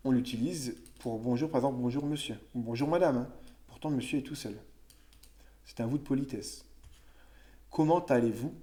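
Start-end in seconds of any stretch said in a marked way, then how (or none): none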